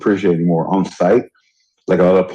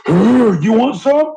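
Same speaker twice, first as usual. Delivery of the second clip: high, slow mocking tough-guy taunt; tough guy challenge; 13 semitones higher, much slower than usual. Imitating a shirtless guy picking a fight, high slow taunt. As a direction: drag out the taunt like a puffed-up brawler. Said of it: "they take their shirt off".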